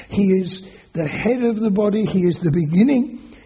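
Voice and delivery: ringing sermon climax; full-force preaching. Acted. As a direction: proclaim the resurrection with conviction.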